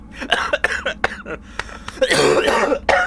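real coughing fit after laughter; hacking cough brought on by laughing. Real, not acted. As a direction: laugh until it turns into hard coughing. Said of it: "I laughed too hard there".

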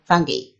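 "fungi" ending in a ghee sound; English pronunciation, as taught. Shown as a demonstration. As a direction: say "fungi" with a hard g, FUNG-ghee. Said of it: "in English, it is".

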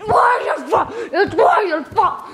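high accusing yells, last one dragged out slowly; blaming shout. Real, not acted. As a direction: shout the blame at him. Blaming teammate after death.

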